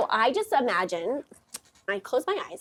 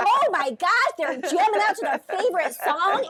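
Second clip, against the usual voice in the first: excited make-believe; 9 semitones higher, 5 dB louder than usual. Pretend. Invents another silly story.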